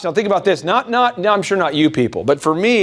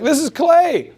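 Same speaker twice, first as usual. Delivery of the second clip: friendly intro; 10 semitones higher, much slower than usual. Shown as a demonstration. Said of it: "I'm like".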